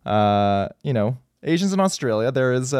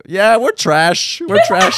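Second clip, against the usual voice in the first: mock proclamation; 8 semitones higher, much slower than usual. Mock quote of guest dissing Australians.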